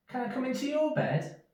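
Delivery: high, pleading little mouse. Acted. Little Mouse asks Big Mouse to share his bed: high pleading voice. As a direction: beg sweetly in a tiny voice.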